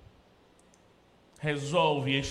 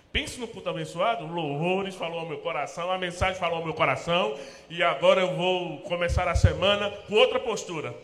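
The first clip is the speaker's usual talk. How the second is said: fired-up churchgoer; 5 semitones higher, 8 dB louder than usual. Retold or imitated. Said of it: "aquela pessoa que termina o culto e diz".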